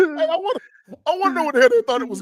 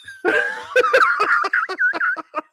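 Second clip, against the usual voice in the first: drawn-out shrieking laugh; long high laugh; 3 dB quieter than usual. Real, not acted. Friend laughing hard at his own joke, long high laugh. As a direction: laugh until out of breath.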